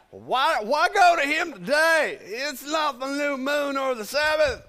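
put-on high, loud, grumbling old man; older husband. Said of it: "of course, he's an older guy".

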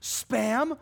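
high, shocked cry of the word Spam; mock yell. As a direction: imitate the roommate yelling in disgust. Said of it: "he starts yelling".

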